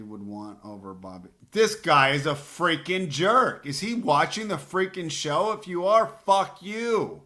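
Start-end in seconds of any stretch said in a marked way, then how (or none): 1.5-7.2 roaring with real rage, much higher and louder; furious shout. Real, not acted. Drafter yelling at the rival drafter who sniped his player, furious shout. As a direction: blow up at the rival who stole your pick. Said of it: "I'm so pissed right now".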